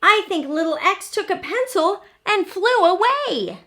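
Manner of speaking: small letter voice. A narrator's reading. Letter character speaking.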